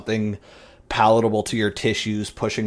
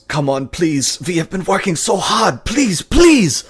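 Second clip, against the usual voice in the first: pleading higher and louder, building to a shout; desperate pleading; 9 semitones higher, 8 dB louder than usual. Acted. Scientist begging his creation to wake, desperate rising plea. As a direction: beg him to live, rising to a cry. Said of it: "and he says".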